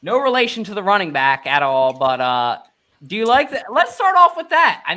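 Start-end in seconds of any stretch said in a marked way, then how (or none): 3.1-4.8 excited host. Real, not acted. Host hyping topic.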